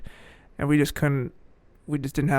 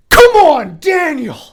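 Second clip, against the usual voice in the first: much higher, loud courtside yell; hollering at the shooter from the sideline; imitating the coach's sideline yell, very high and loud; 12 semitones higher, 11 dB louder, much slower than usual. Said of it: "He just yells that".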